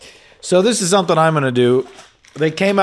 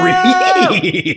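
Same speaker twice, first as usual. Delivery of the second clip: mock shock; 5 dB louder than usual.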